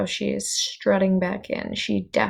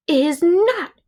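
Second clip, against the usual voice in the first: put-on high, loud childish shout of defiance; 9 semitones higher, 6 dB louder than usual. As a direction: yell it back at the parents, stubborn. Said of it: "shouted the princess".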